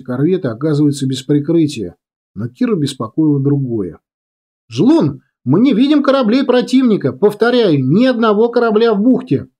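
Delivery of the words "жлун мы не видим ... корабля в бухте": high-pitched radio message; urgent report. A narrator's reading. Scout reporting empty bay to command, urgent report. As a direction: call in the finding insistently.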